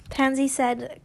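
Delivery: ordinary delivery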